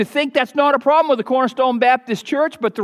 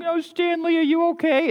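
higher, softer coddling mother voice; doting mother; 8 semitones higher, 3 dB quieter than usual. Retold or imitated. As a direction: coo over the crying boy like a doting mom. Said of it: "a loving mother would come along".

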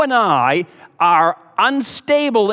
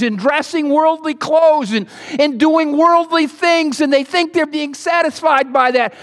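preaching tantrum; 9 semitones higher than usual. Acted. Preacher scolds the congregation in a hammed-up tantrum. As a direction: rail at them, building to a shouted stop it. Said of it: "Think I'm throwing a tantrum? I am".

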